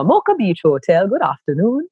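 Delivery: hotel receptionist greets a caller in formal standard Jamaican. Retold or imitated. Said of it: "very formal, kind of standard Jamaican".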